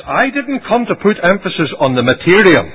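high, loud preaching refusal; emphatic rejection. Retold or imitated. Christ telling the people what he refused, emphatic. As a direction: push it out forcefully as a denial.

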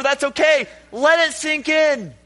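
ordinary talk, nothing marked

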